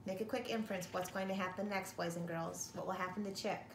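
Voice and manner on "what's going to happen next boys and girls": ordinary delivery, nothing marked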